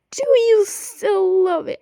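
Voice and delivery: high, loud, drawn-out mock pleading; mocking imitation. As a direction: imitate a needy question, slow and overdone. Acts out his typed question.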